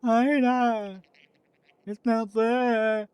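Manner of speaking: high, slow put-on little voice; high slow character voice. A voice inside the body tells them, high and slow. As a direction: draw it out slowly in a high squeak.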